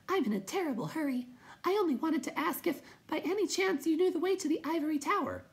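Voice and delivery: very high, tiny hurried voice; timid creature. A narrator's reading. Will-o'-the-Wisp asks the way, high hurried voice. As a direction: pipe it quickly, very high.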